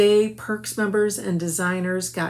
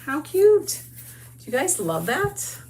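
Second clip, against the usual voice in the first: high, loud delighted squeal; 8 semitones higher, 4 dB louder than usual. Real, not acted. Crafter admiring her finished hanger, delighted squeal. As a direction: gush over the finished hanger.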